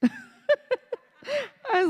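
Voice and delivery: slow words through laughter; laughing at the memory. Real, not acted.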